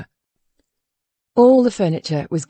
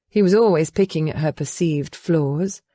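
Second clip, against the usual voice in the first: usual speaking voice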